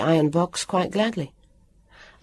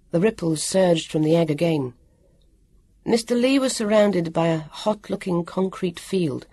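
usual speaking voice

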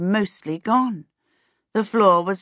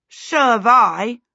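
slow, louder, heartfelt agreement; emphatic, higher-pitched fellow suffering; said feelingly; 4 semitones higher, 5 dB louder, slower than usual. A narrator's reading. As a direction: stretch it out with feeling. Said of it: "Peter said feelingly".

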